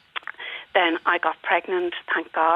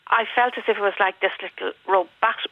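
ordinary talk, nothing marked

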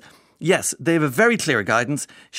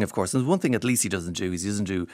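usual speaking voice

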